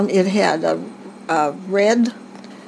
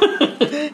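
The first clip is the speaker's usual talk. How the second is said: laugh; 8 semitones higher than usual. Real, not acted. Laughing at the sand pit danger.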